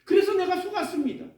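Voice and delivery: high, rising accusing lament; Jeremiah's complaint. Retold or imitated. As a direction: accuse God of deceiving you. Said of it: "예레미야 선지자가 하나님 앞에 뭐라 그러냐면".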